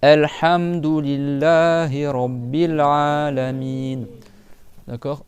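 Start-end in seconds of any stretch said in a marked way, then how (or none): 0.0-4.1 slow chanted "Alhamdulillahi Rabbil Alamin"; slow Quranic recitation. Shown as a demonstration. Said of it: "Donc on récite ensemble".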